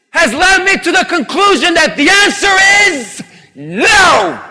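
loud, rising lecture to God; mock know-it-all believer. Preacher voicing a smug doubter answering God. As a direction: argue your assessment at God, shouting.

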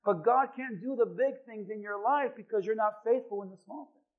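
usual speaking voice

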